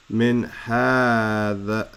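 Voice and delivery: "min hadha" very slow, long vowel in ha; drawn-out reading. Shown as a demonstration. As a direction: stretch out "min hadha" word by word. Teacher reads the phrase out slowly.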